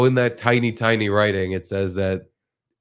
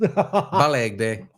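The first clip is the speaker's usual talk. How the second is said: mock Jamaican; 2 semitones higher than usual. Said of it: "Jamaican accent".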